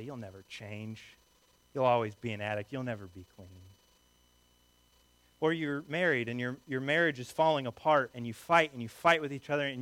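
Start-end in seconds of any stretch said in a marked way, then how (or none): 0.0-3.5 soft, low, discouraging voice; low dismissive verdict. Acted. Relative or friend arguing with an addict, quiet and dismissive. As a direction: write them off quietly, pitched down. Family member voiced in an argument.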